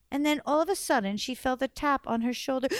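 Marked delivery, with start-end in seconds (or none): none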